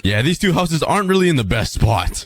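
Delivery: normal talking voice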